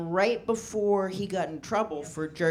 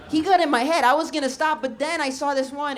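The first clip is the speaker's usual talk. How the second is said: high and louder; excited; 8 semitones higher, 4 dB louder than usual. Real, not acted. Comic telling crowd, high excited. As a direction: keep the giggly energy. Laughing while talking.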